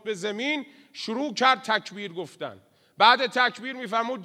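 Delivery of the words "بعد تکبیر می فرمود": normal talking voice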